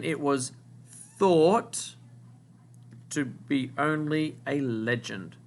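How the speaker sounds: slow, phrase by phrase, with long pauses; slow dictation. Shown as a demonstration. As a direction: read it out slowly so the class can write. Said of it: "word that you need to write down".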